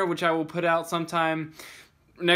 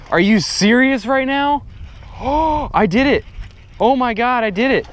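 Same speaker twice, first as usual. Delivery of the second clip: high, loud and gasping; overjoyed disbelief; ecstatic; 8 semitones higher, 6 dB louder than usual. Real, not acted. Huge fish caught.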